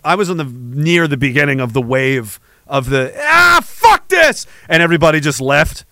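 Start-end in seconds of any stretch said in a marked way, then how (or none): 3.2-4.5 high, drawn-out outburst of exasperation; mock exasperated cry, pitched way up; mock fed-up yell. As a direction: imitate people giving up in disgust. Mimics the crowd storming off.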